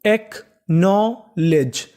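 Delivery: "Acknowledge" very slow, in separate pieces; teacher stretching "acknowledge" out chunk by chunk; syllable by syllable. Shown as a demonstration. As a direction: sound out "acknowledge" with a gap after each part. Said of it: "तो पूरी meaning".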